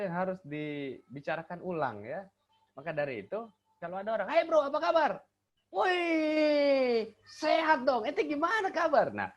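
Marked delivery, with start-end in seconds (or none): 4.3-5.2 high, lively hail to a friend; excited greeting. Acted. Someone arrives and greets a friend, excitedly. 5.7-9.1 high, drawn-out hearty call back; match your friend's excitement; matching enthusiastic reply. Shown as a demonstration. Replying to a friend's greeting in tune, enthusiastically. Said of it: "harus ada keselarasan".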